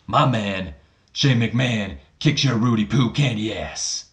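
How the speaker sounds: mock Booker T trash talk; Booker T impression. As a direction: taunt The Rock as a so-so Booker. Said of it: "Not the best Booker".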